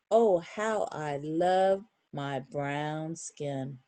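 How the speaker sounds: slow, lilting picture-book read; reading to children. Acted. Reader to kids, closing the book, slow sing-song read. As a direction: savour each word of the refrain. Reads the refrain slowly.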